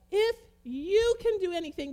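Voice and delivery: "you" hit high and loud; stress on you. Shown as a demonstration. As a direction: punch "you" as if it had a capital letter. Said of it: "there's a capital Y".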